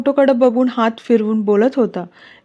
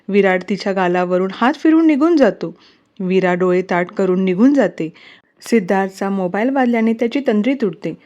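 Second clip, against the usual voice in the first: ordinary delivery, nothing marked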